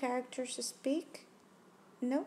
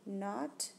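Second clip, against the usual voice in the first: usual speaking voice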